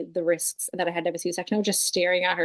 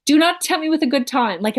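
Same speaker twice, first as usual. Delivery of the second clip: normal talking voice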